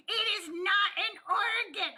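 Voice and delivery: mock emphatic contradiction, drawn out word by word; mocking imitation. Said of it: "And he was like".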